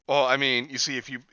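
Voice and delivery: ordinary talk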